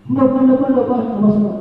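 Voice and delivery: put-on high, rapid run of no's from a child; pupil refusing. Hurt pupil refusing the massage, high and quick. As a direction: shake off the offer like a teary boy. Crying boy refuses repeatedly.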